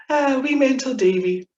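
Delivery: loud, high, fond exclamation; fond delight. Real, not acted. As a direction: relish the name lovingly. Fond exclamation over the name.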